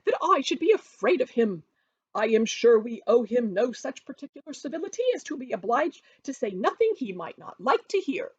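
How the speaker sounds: high, loud, defiant matron; indignant Mrs Bennet crying out. A narrator's reading. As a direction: cry out defiantly, not caring who hears. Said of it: "cried Missus Bennet".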